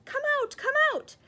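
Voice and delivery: high call from far off; distant beckoning voice; faraway call. Acted. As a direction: sing it out from the backyard. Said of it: "she heard the voice say".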